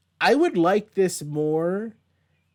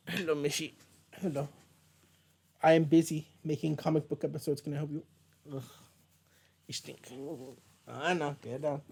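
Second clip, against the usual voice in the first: talking to dog; 7 dB quieter than usual. Real, not acted.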